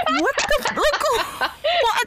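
high squeaky laugh through the words; laughing disbelief. Real, not acted. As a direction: crack up mid-sentence in disbelief. Laughs at own absurdity.

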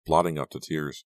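ordinary delivery, nothing marked

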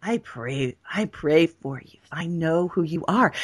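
low, soft, slow assurance; calm knowing tone. Shown as a demonstration. Showing listeners the right way to pray for someone, calm and soft. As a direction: say it quietly, with steady faith. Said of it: "It's in the energy of our knowing".

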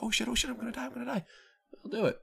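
high-pitched panicked squeal, held back in volume; mock screaming. Shown as a demonstration. Gamer demonstrating his screaming for the stream, mock panic squeal. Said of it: "If I wanna just start screaming".

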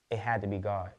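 ordinary talk, nothing marked

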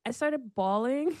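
ordinary delivery

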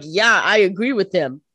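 loud, slow, drawn-out agreement; mock emphatic agreement. Damon answering Elena about her feelings, mock emphatic agreement. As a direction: lean hard on it as Damon.

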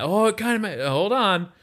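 high, rising mock disbelief; imitating amazed spectator. Spectator at the card trick, mock amazed and high.

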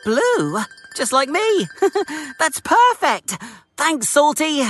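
high, gleeful little engine with a giggle; delighted Thomas. A narrator's reading. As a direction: bubble over with delight. Thomas delighted with blue wood.

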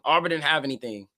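usual speaking voice

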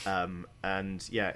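normal talking voice

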